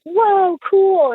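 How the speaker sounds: drawn-out, high wowed exclamation; wowed reaction. Retold or imitated. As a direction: play an impressed gawker. Whole family's awed reaction, acted.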